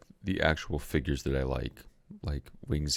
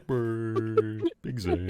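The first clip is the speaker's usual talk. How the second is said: goofy mech voice; 6 semitones higher, much slower than usual. Retold or imitated. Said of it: "He goes".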